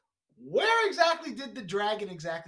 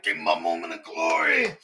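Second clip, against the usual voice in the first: overacted death scene; 12 semitones lower, 5 dB louder than usual. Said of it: "he just dies dramatically".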